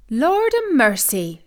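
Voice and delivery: slow surprised exclamation; exclaiming. A narrator's reading. Wife exclaiming at news from heaven, slow surprise. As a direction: gasp it out in wonder. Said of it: "she exclaimed".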